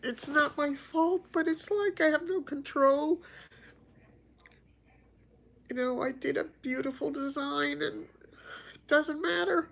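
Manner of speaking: ugly crying, voice pitched high; talking through tears and sniffles; snotty crying. Real, not acted. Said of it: "this is the ugly cry".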